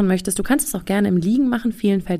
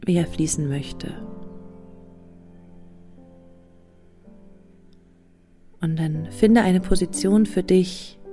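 guided meditation; slower than usual. Acted. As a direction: guide them into calm, slowly. (ruhige Meditationsstimme)

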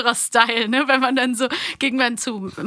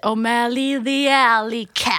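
quoting the English film; slower than usual. Retold or imitated. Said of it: "Und der sagt auch im Englischen".

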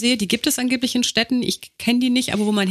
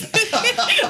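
burst of laughter; 14 semitones higher than usual. Real, not acted. Co-host breaks out laughing at the medicine names. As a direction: crack up at the joke.

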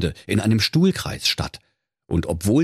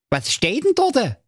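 put-on high cheeky young lad; chirpy, pitched-up hero voice; Schnitzelschorsch voice. A narrator's reading. Narrator voicing Schnitzelschorsch, a cheeky high voice. Schorsch asks.

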